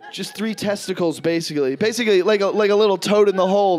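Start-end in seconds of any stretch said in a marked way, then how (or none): none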